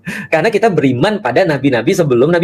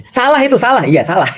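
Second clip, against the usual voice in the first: laughing pun; 8 semitones higher than usual. Real, not acted. Joke about the name Salah.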